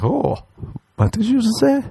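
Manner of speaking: very high, eager what; delighted surprise. Pretend. The girl called him Papi, very high delighted. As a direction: perk up, thrilled.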